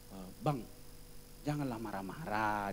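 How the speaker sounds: low, soft, careful request; softly voiced timid reproach; gentle plea. Pastor's child asks him not to be angry, gentle plea. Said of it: "anak pendeta itu bilang sama saya".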